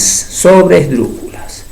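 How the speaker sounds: "sobresdrújulas" stretched out syllable by syllable; slow, deliberate. Shown as a demonstration. Teacher repeating a new term, "sobresdrújulas" said slowly. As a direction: spell out "sobresdrújulas" slowly by ear.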